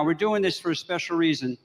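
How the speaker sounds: usual speaking voice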